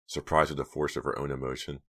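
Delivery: ordinary delivery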